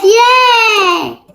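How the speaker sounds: high, loud little yay; excited cheer. Real, not acted. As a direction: squeal it out in joy. Cheering at the result.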